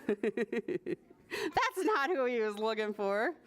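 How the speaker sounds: laughing aside, drawn out; amused. Real, not acted. Reader to children about the wrong animal, laughing. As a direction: say it through a chuckle.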